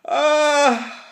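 high loud cry of pain. Real, not acted. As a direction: cry out, mouth on fire. Pain from heat.

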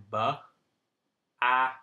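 each sound said separately; sounding out phonemes. Shown as a demonstration. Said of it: "this has three phonemes".

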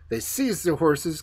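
plain reading voice

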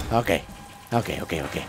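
ok muy bajito, nervioso; susurrando nervioso. Real, not acted. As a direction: murmullo casi inaudible y tenso. Se calma tras gritar. (susurro nervioso)

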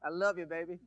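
normal talking voice

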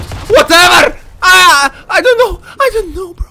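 high-pitched, frantic pleading; panicked outburst. Real, not acted. Player offers the monster anything, panicked outburst. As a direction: cry out helplessly, stumbling over words.

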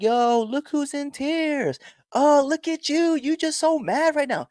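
very high, drawn-out mocking call; gloating taunt. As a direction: rub it in, slow and high-pitched. Taunting the crying kid and mother.

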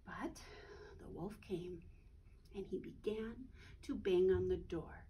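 low hushed whisper; suspenseful whisper. A narrator's reading. Narrator building suspense for children, whispering. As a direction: whisper it like a secret.

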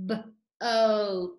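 boat drawn out slowly, B then oat; stretched out. Shown as a demonstration. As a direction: stretch boat into its sounds, oat held long. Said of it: "Let's stretch it out".

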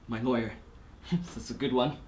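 scornful chuckle. Acted. Scornful chuckle about his lawyer.

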